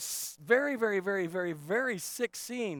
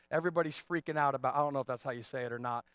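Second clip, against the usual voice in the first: normal talking voice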